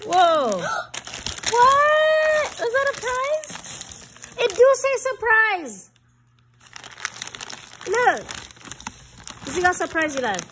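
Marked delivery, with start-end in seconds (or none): 0.0-0.6 loud, high astonished whoa; surprised. Real, not acted. Opening a surprise box. 1.5-8.2 high, loud, excited squeal. Real, not acted. Opening a surprise box.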